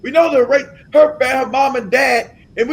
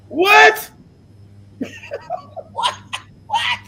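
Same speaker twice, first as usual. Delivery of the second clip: incredulous; much slower than usual. Acted. Speaker ridiculing the Kenyan's story, incredulous shout. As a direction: shriek the question, pitch shooting up. Mock disbelief, breaks into laughter.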